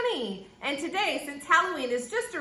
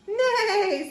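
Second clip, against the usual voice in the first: high, wavering whinny; whinny it like a horse; horse neigh; 8 semitones higher, 4 dB louder than usual. A narrator's reading. Horse answering from under the mask, high neighing. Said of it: "says the horse".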